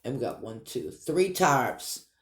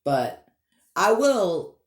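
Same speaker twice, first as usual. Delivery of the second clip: usual speaking voice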